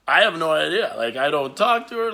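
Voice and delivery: loud, flustered "I have no idea"; loud flustered denial. Retold or imitated. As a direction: blurt out the denial loudly.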